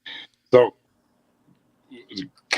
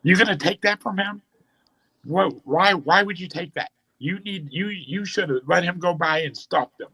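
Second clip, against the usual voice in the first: much higher, mock-frantic coaching yell; imitating a worked-up friend screaming; 12 semitones higher than usual. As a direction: egg the kid on, voice pitched way up. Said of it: "just starts screaming".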